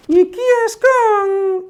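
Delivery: high, slow cartoon puppet voice; Mickey puppet voice. Puppet Mickey arriving to meet the children, high slow voice. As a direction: stretch it out like a shy puppet.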